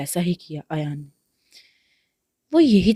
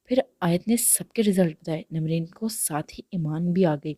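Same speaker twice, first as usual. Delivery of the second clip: ordinary delivery, nothing marked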